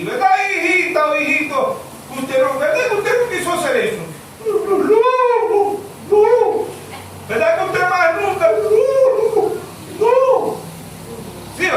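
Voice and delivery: put-on high, fond wheedling of a child; coaxing parent. Preacher mimicking a parent coaxing a naughty child, high and fond. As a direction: coax a child into saying no, high and sweet.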